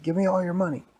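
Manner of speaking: high, loud storybook bully; hammed-up shakedown; bully's demand.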